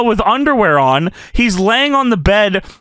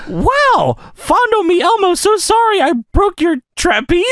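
high-pitched puppet voice; Elmo; 9 semitones higher than usual. Acted. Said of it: "Elmo says".

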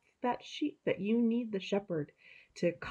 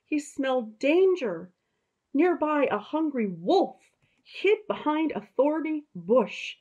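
higher, storybook narration; slow, higher storyteller voice; reading to children; 8 semitones higher, 4 dB louder than usual. A narrator's reading. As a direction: tell it like a bedtime story to kids.

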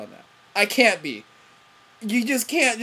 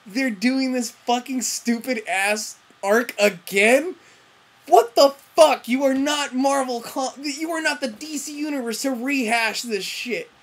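exasperated rant. Retold or imitated. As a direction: vent disbelief at the writers. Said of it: "I'm just like".

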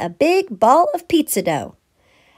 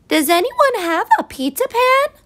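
hen calling out; 12 semitones higher than usual. A narrator's reading. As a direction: call out the window like a hen. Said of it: "she called".

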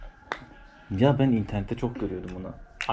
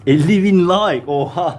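shocked; 8 semitones higher, 7 dB louder, slower than usual. Real, not acted. Shocked at the like goal.

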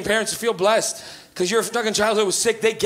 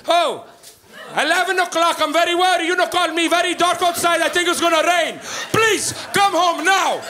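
put-on high, pleading accented mom voice; worried Portuguese mom; 5 semitones higher than usual. Portuguese mother pleading on voicemail at eleven, worried. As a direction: beg him to come home, fretting. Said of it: "And then it would change".

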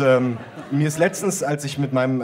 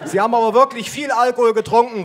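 much higher, louder female cop; policewoman calling; 10 semitones higher, 5 dB louder than usual. Acted. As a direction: call out the accusation. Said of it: "ruft die Polizistin".

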